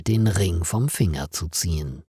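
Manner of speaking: ordinary delivery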